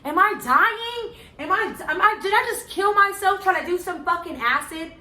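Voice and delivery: panicked. Retold or imitated. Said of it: "So I'm like".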